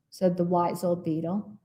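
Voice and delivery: ordinary delivery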